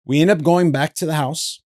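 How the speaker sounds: ordinary talk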